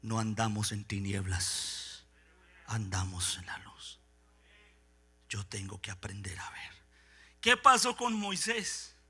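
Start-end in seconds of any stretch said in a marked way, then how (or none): 0.0-6.7 susurrado, lento y mucho más bajo; susurro. Acted. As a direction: dilo en un susurro íntimo. Cierra el punto en susurro. (susurro lento y grave)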